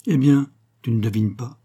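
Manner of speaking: ordinary delivery, nothing marked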